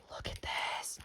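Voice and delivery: awed whisper. Real, not acted. Showing viewers the sparkle dots, an awed whisper. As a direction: breathe it out in wonder.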